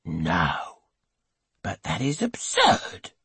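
low, slow voice of the husband; he brushes her worry aside; husband protests. A narrator's reading.

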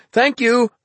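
high, loud grateful shout; yelled thanks. A narrator's reading. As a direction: shout it back while running off. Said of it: "Peter yelled".

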